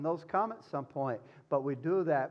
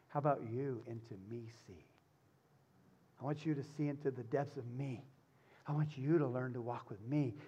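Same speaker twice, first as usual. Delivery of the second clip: tender invitation; 3 semitones lower, 5 dB quieter than usual. Retold or imitated. Jesus voiced tenderly.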